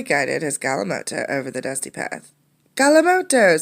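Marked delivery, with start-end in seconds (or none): 2.8-3.6 high, loud joyful cheer. Acted. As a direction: shout it out in delight. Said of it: "someone cheered".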